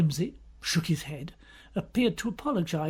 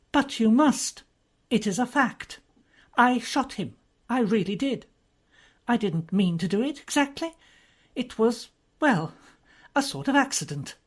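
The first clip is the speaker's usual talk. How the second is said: lightly voiced earnest young woman, a bit higher; Lady Mary's voice; 7 semitones higher, 4 dB louder than usual. Lady Mary insisting to the policeman she shot him, lightly voiced. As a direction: insist on it as plain fact.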